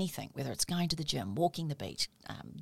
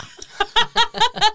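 high burst of laughter; 13 dB louder than usual. Real, not acted. Laughing at the margarine story.

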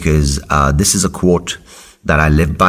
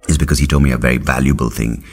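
usual speaking voice

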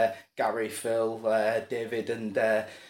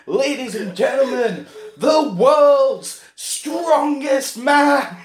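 higher, louder, slow drawn-out showman voice; ringmaster announcement; 8 semitones higher, 4 dB louder, much slower than usual. Acted. Showman to the crowd, drawn-out grand announcement. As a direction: stretch it out like a circus ringmaster. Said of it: "And the showman goes".

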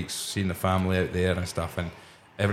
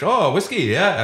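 put-on a light Indian bartender accent, pitched up; imitated Indian accent; 8 semitones higher, 4 dB louder, slower than usual. Indian bartender guessing he's Scottish, imitated Indian accent. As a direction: Indian barman clocking a Scotsman, mock Indian accent. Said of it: "Is that allowed to do that accent?".

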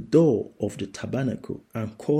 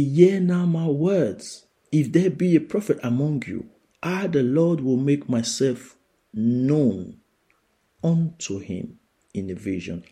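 preaching scripture; 4 semitones higher than usual. Acted. Preacher reading the Lord's words to the church, proclaiming. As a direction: declare it as the Lord speaking.

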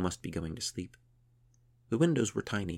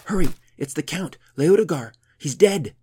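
high, loud alarmed shouting; breathless shout; 10 semitones higher, 15 dB louder than usual. A narrator's reading. Priest outside the prison door, shouting breathlessly. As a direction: yell it through the door in a panic. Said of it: "a breathless voice shouted".

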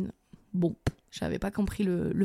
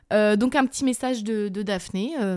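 ordinary talk, nothing marked

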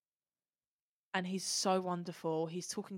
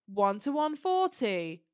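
loud, slow imitated announcement; Scottish steward's call; 3 semitones higher, 6 dB louder, slower than usual. Scottish steward calling the fan's ticket number, imitated loud call-out. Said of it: "he was Scottish, this guy at Comic Con".

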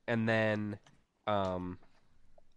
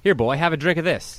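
louder, higher, pushy offer; acted Asbury; 6 semitones higher, 7 dB louder than usual. Asbury offering Morgan a drink, loud and high. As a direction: call it out as you thrust the glass.

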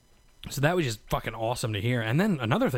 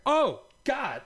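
shocked gasp; 12 semitones higher than usual. Retold or imitated. Sees the blood after surgery.

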